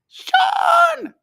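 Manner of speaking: very high, loud holler of a name; mock calling out. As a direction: call out playfully, stretching the name. Acting out calls between chat friends.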